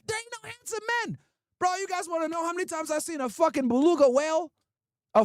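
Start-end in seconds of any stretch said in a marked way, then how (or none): 0.1-1.2 mocking high voice. Mocking women's complaint.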